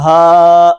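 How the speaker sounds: ہا drawn out softly from the chest. Shown as a demonstration. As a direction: round ha as a model. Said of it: "سینے کی طرف سے ادا ہوتا ہے".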